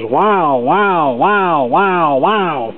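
wavering pitch imitating record wow; wobbly tape warble. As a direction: warble the wows up and down like a warped record. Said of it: "as you can imagine, when the record played".

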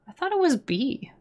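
ordinary delivery

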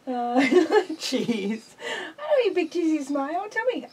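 high, coaxing sing-song pet voice; pet talk. Real, not acted. Owner coaxing her pet bird to say I love you, high coaxing voice. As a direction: sweetly coax the bird to speak.

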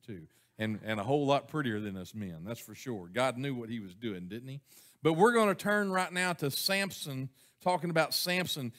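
ordinary talk

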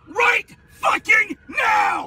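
berserk rage. Real, not acted. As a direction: scream the insult at her. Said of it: "You have no right to scream at me".

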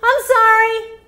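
very high, loud whiny confession; hammed-up child voice; self-blaming child. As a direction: play her younger self who feels wrong. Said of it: "I'm like".

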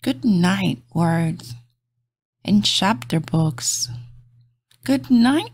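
gentle bedtime storybook read; reading to children. Acted. Reading a bedtime picture book aloud to kids, soft and gentle. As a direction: lull little listeners toward sleep.

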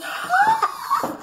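a high, gasping cry of shock with laughter; girl shocked at the necklace. Acted. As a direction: gasp it out in astonishment. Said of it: "she's shocked".

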